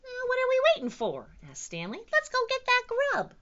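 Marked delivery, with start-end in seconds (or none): 0.0-1.3 high, fast, loud little voice; eager little dinosaur. A narrator's reading. Stanley urges his brothers on, eager high voice. 2.1-3.3 high, loud little voice; eager little dinosaur. A narrator's reading. Stanley urges his brothers on, eager high voice.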